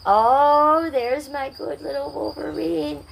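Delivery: cooing pet voice. Real, not acted. Greeting her pet Wolverine on stream, high sing-song baby talk. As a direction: coo lovingly at the little animal.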